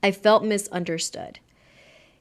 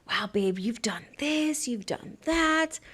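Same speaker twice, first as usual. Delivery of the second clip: higher, slow, softer sweet-talk; soft mock gushing; 3 semitones higher, 6 dB quieter, slower than usual. To her partner in the car, slow soft higher cooing. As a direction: coo it like praising your partner. Acting out couple's gushing exchange.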